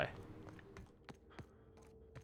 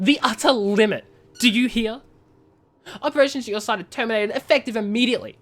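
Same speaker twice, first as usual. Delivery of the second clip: angry boss; 5 semitones higher, faster than usual. Acted. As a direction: lay into the failing manager. Reads a furious boss's termination letter.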